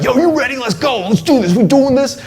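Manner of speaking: high, pumped call to go; firing up the boys for a fight; hyped-up bro. Retold or imitated. Bro hyping his friends to fight, pumped high call.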